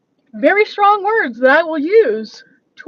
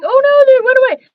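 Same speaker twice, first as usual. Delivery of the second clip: high, loud startled oh no; blurting out surprise as something vanishes; real dismay; 11 semitones higher, 7 dB louder than usual. Real, not acted. Dismayed streamer as something vanishes from her screen, high and loud.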